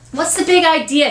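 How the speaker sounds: high-pitched challenge; indignant boy. Acted. Boy storming in to challenge his mother, indignant. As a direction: object like a put-out kid.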